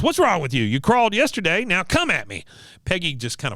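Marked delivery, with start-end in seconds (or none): 0.0-2.4 high-pitched mock drill sergeant; Cotton. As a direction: goad the trainee in a put-on high voice. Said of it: "he goes".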